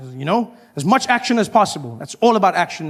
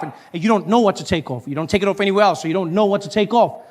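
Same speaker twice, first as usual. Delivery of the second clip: ordinary talk, nothing marked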